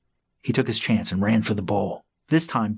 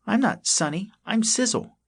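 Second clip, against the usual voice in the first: put-on high, small young critter voice; a timid little possum speaking up; 12 semitones higher than usual. A narrator's reading.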